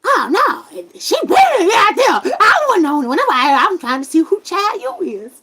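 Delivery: high, hollered denial, imitated; loud protest. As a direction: mimic a man indignantly clearing himself. Acts out man's protesting denial.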